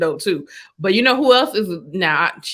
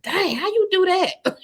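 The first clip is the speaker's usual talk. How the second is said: amazed; 9 semitones higher, slower than usual. Retold or imitated. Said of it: "make me be like".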